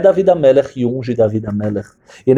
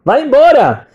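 high, loud acted order; shouted dismissal; 12 semitones higher, 5 dB louder than usual. Prophet Shmuel rejecting David as he enters, loud high dismissal. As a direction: send him away with a high shout.

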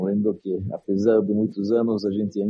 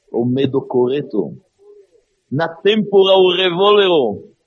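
loud, high impassioned sermon; preaching at full force; 6 semitones higher, 8 dB louder than usual. Acted. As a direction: hammer the point home, preaching. Preaching the Rebbe's courage.

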